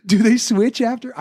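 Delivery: high mock giggle running into a slow question; squeaky-high retold giggly voice; giggling kid. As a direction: imitate a kid tickled by his own question. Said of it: "And he starts giggling".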